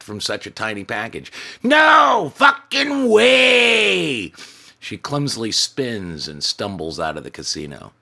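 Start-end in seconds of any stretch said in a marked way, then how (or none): 1.6-4.3 long, drawn-out, very high and loud bellow. Acted. Tiny drunk woman bellowing her refusal at the manager. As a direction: bellow it far bigger than your size. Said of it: "she bellows at a volume".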